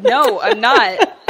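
laughing denial. Real, not acted.

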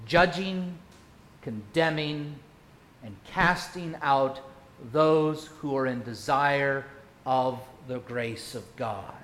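ordinary delivery

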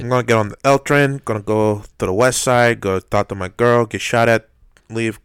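loud Chicago local bit; Chicago accent. Pretend.

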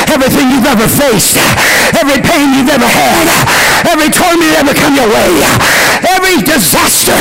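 fast, climbing preacher's run; preacher building to a peak; rising sermon build. Acted.

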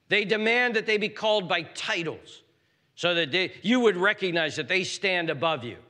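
impassioned preaching. Real, not acted. Preacher warns church of domineering leaders, impassioned preaching. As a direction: denounce them with mounting force.

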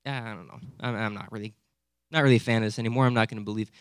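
low, hesitant, noncommittal mumble; half-hearted doubt. Acted. Preacher voicing a wavering Thomas, half-hearted. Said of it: "it's not just Thomas saying, like".

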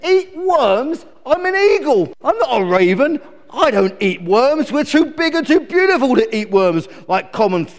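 high, loud indignant eagle; appalled, self-important eagle; outraged cry. Acted. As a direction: squawk in haughty disbelief. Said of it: "cried Glen".

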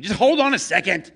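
high, loud, impatient yell; angry bark. Acted. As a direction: cut her off gruffly. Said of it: "The angry man inside is like".

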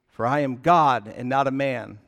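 preacher booming the key verse; loud declaration. Shown as a demonstration. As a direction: announce it to the room with force. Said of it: "When I say to you the phrase".

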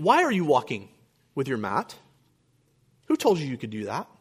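understated Pharisee reproach; mock disapproving questioning. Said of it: "They're saying".

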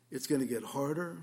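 ordinary talk, nothing marked

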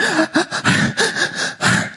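put-on a child's sharp, breathless sob-gasp. Preacher mimics a crying child, sharp gasp.

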